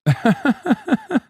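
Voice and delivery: high, rapid burst of laughter; staccato high-pitched laugh. Real, not acted. Streamer laughs after his remark.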